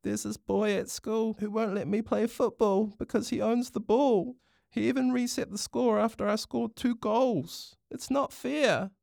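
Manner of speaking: put-on high, plaintive child's voice; fighting back tears. A narrator's reading. Hinakura explains the bully to Papa, near tears. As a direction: pour out the grievance in a high whine. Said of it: "Hinakura fought back tears as she explained".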